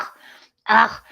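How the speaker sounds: loud, fed-up groans of dismissal; dismissive ugh. Retold or imitated. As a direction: brush them off with a groan. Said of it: "it's gonna sound like".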